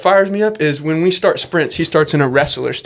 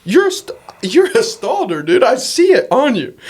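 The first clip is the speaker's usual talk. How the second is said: high, excited double take; stunned recognition; 9 semitones higher than usual. Retold or imitated. Coach seeing the Stalder kid at practice, high amazed. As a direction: spot the family face, amazed. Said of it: "and I went".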